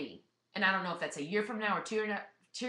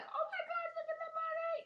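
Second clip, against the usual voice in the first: much higher, thrilled mock squeal; excited squeal; 7 dB quieter than usual. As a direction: squeal with delight at the money. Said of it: "you're gonna be like".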